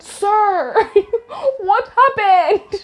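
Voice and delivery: a pompous question that dissolves into a laugh; mock MP question. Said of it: "being asked by members of parliament".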